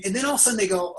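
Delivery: usual speaking voice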